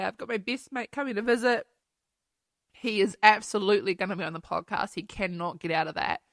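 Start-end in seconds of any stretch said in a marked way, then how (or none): none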